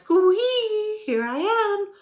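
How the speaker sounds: high, loud sing-song call. Acted. Wilbur's hidden voice calling to the searching elephants, high and loud. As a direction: call it across the jungle. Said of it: "It called".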